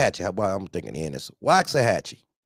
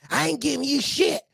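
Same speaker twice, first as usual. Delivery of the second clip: mock angry woman; 12 semitones higher than usual. Woman refusing keys.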